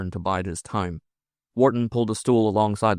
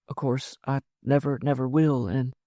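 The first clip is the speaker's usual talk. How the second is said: higher, hushed woman's voice; yielding woman; 5 semitones higher than usual. Acted. Woman yielding to her lover, hushed and tender.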